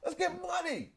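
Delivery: high excited cheer; high hype cheer. Real, not acted. Hype shout.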